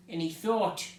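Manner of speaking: plain reading voice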